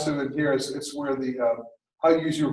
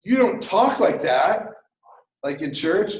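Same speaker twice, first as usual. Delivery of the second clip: churchgoer friend; 3 semitones higher, 3 dB louder, slower than usual. Retold or imitated. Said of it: "would read one of my papers and say".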